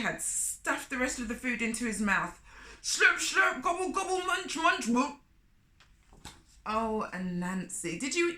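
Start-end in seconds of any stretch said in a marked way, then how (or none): 2.8-5.2 higher, louder eating noises; greedy slurps and munches; playful gobbling sounds; slurping and gobbling. A narrator's reading. Anansi stuffs the food.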